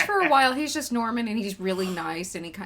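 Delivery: ordinary delivery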